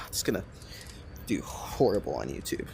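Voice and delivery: muttered whisper aside. Real, not acted. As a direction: say it under your breath. Worried aside about video.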